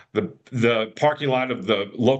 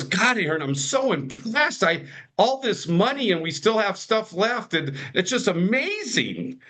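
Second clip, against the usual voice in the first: higher, excited retelling of dad's praise; acted-out amazement, pitched up; impressed dad; 7 semitones higher than usual. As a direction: voice an amazed, proud father. Voicing his impressed, amazed dad.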